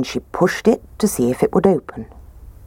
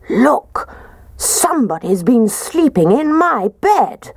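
Daddy Bear; 8 semitones higher than usual. Acted. Daddy Bear discovers bed.

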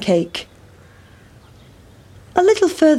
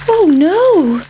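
high, louder storybook cry of alarm; alarmed pancake; 9 semitones higher, 5 dB louder than usual. Acted. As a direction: play the dismayed pancake, pitched way up. Pancake alarmed at being eaten.

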